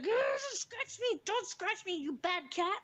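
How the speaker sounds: put-on high, slow child growl at the cat; scolding child voice. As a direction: growl and scold like a little girl. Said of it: "She's like".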